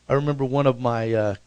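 ordinary delivery

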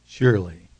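Surely said low and weighty; a low, stressed Surely; emphasized Surely. Retold or imitated. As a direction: lean hard on Surely, deep and low. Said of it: "the Holy Spirit just emphasize".

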